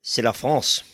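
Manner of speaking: playful French flourish on the answer; French accent. Pretend. Contestant answering the quiz host in French, French accent. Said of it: "I'm not saying it like that".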